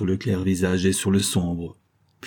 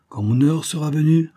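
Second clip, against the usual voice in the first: a higher, louder demonic retort; the dark giant answering back in contempt; defiant giant; 8 semitones higher, 4 dB louder than usual. Acted. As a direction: scorn the mortal who dares threaten him. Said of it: "répliqua-t-il".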